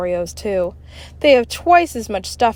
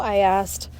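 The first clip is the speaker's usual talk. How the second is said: plain reading voice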